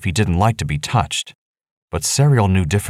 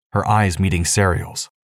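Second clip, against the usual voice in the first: plain reading voice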